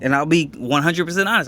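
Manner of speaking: ordinary talk, nothing marked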